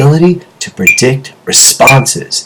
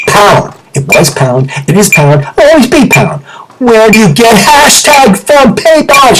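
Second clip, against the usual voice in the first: mock outrage; 10 semitones higher than usual. Ranting about the pound sign.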